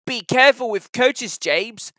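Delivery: a lightly voiced wise engine giving advice; Edward the engine. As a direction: warn the young engine gently but firmly.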